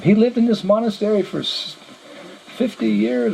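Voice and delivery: high-pitched acted disbelief; incredulous questioning. Monk doubting the visitor knew Father Pierre, incredulous. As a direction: challenge him, voice lifted high.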